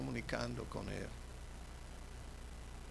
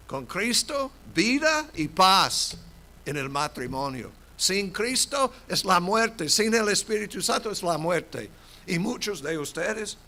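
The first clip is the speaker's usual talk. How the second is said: loud, higher preaching cry; full-force preaching; 7 semitones higher, 10 dB louder than usual. Acted. Pastor proclaiming to the congregation, loud preaching. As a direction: preach it out to the whole church.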